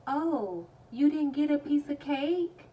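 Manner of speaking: high, loud exaggerated question; loud put-on surprise; mock concerned hostess. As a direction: imitate her with a big rising "cake?". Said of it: "She's like".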